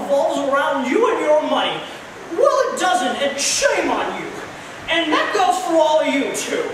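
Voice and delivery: a loud righteous scolding with a laugh through it; James Stewart voice. Retold or imitated. George scolds the rich rival Potter, high and loud. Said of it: "an excellent actor named James Stewart".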